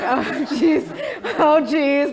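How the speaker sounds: laughing, nervous high oh jeez. Retold or imitated. Student opening her law school letter, laughing nervously. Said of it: "I'm so nervous".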